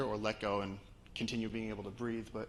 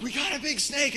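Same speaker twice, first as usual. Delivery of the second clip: high, loud excited yell; urgent call across the distance; scream to everybody; 8 dB louder than usual. Retold or imitated. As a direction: holler the alarm to the whole team. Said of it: "I scream to everybody".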